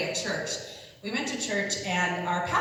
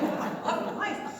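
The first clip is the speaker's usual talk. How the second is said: laughing; 10 semitones higher than usual. Retold or imitated. Wife reacting to news.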